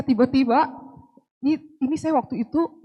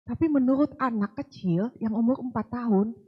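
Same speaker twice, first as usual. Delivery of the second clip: ordinary talk, nothing marked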